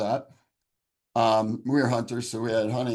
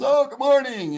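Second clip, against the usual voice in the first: bright, much higher, louder mock-cheerful hello; cheery greeting; 12 semitones higher, 5 dB louder than usual. Stranger greets the suicidal man at his door, much higher and cheery. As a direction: imitate a beaming stranger's chirpy good morning. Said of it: "he's all happy and excited".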